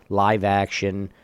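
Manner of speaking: ordinary talk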